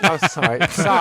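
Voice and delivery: ordinary talk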